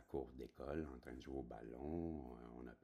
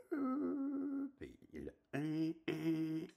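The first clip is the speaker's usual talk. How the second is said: loud high honking imitation; mock honk, high and loud; imitated horn blast; 9 dB louder, much slower than usual. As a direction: blare it like a bus horn. Horn and brakes, imitated sound.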